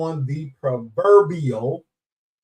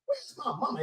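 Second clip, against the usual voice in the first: mock searching; 12 dB quieter than usual. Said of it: "sometimes I be like".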